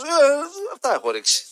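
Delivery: hesitant clueless extra; mumbling extra. Retold or imitated. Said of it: "Έτσι κι αυτός".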